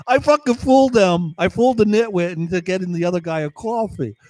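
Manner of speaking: high, excited triumph; gleeful gloating. Real, not acted. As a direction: crow about the prank. Gloating over prank.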